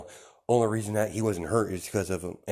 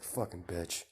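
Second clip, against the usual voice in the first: muttered insult; 2 semitones lower, 10 dB quieter, slower than usual. Acted. Son cursing his mother while storming off, muttered. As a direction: mutter it much softer, as an aside.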